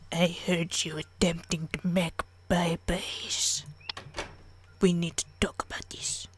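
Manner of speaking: slow, hushed, lightly put-on parent; slow, breathy mock seriousness. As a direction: half-whisper a hammed-up parental talking-to. Mock stern parent aside.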